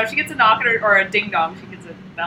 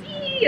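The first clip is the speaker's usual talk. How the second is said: high squeak; a quick high mock squeal; creature squeal. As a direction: squeal like a tiny frightened thing. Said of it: "writes these little things like".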